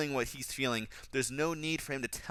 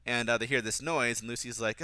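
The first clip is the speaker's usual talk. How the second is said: normal talking voice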